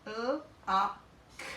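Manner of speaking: normal talking voice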